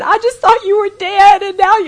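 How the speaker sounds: high, loud overwrought wail, imitated; retold sobbing mother. Mother to the husband she thought dead, imitated distraught outburst. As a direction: play a mother blurting it out, overcome. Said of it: "my mother is sobbing".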